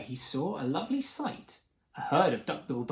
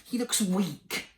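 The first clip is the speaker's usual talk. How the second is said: high, slow grumble; drawn-out dismay at the weakling; grumbling; 4 semitones higher, slower than usual. A narrator's reading. Mother dinosaur about baby number three, high drawn-out grumble. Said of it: "she grumbled".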